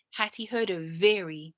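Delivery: usual speaking voice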